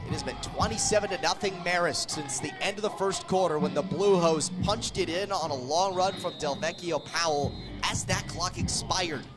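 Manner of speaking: ordinary talk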